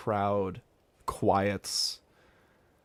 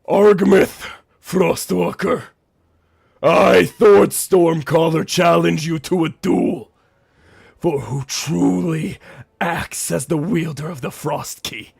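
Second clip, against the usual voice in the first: formal challenge; 7 semitones higher, 11 dB louder than usual. Acted. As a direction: declare the duel for all to hear.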